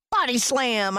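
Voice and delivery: high excited yell; a boy's gleeful shout to his dog. A narrator's reading. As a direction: call it out like a wrestling announcer. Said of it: "I yelled".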